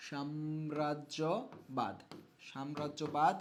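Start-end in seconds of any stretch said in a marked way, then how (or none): 0.1-2.0 very slow, low and softer; dragged out for students to copy; slow dictation. Shown as a demonstration. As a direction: stretch the word syllable by syllable. Teacher spelling out the term slowly.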